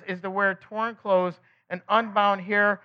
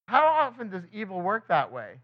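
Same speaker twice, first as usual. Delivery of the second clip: ordinary delivery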